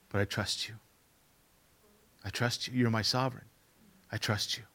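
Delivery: soft, low prayer of trust; quiet surrender. Acted. Preacher praying to God amid loss, quiet surrender. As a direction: let it drop to a hushed, trusting prayer.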